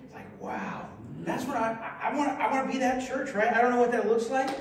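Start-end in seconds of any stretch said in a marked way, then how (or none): 0.0-0.9 a whispered wow, much lower; quiet awed wow after reading letters; quiet humble wow. Shown as a demonstration. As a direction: breathe it out humbly. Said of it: "it was a quiet".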